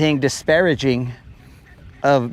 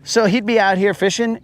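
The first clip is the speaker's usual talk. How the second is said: ordinary talk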